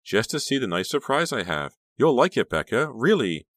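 high, loud, excited coaxing; gushing, insistent persuasion; urging. A narrator's reading. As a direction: talk them into it eagerly. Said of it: "Honey urged".

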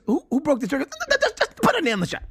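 very high, frantic-paced imitation; hammed-up high-pitched character outburst; Bob impression. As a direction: mimic him in a high, rushed gabble. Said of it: "And he goes".

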